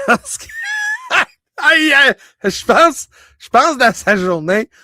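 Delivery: parler à travers le rire, voix haute; en riant. Real, not acted. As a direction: rire en racontant. Rit en racontant les taquineries. (paroles entrecoupées de rires)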